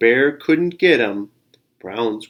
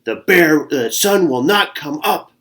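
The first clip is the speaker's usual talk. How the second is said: grumpy bear; 4 semitones higher, 5 dB louder than usual. Acted. Bear grumbles at the dawn.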